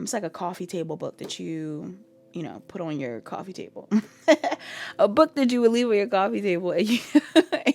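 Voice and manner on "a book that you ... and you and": giggling through the words; trying to hold back a laugh. Real, not acted. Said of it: "I'm trying not to laugh".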